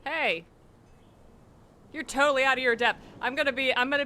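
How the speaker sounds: loud mock scolding; yelling at cops. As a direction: shout down the cop. Yells at game cops.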